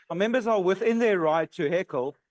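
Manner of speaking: ordinary delivery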